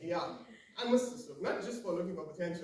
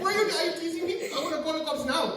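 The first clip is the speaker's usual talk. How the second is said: very high, loud mock indignation; hammed-up affronted vendor squawk; offended Pakistani seller; 12 semitones higher, 12 dB louder, faster than usual. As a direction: threaten the mimic in outrage. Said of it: "He's like".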